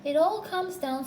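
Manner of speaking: high, mildly cross grumble; understated high petulance. A narrator's reading. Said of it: "said Pooh crossly".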